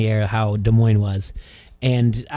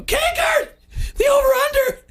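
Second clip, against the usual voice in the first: very high, cracking yell re-enacted. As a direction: yell it across the press row till the voice cracks. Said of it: "and my voice cracked".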